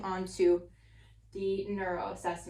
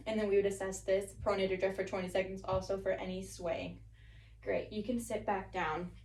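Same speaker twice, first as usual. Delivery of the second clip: usual speaking voice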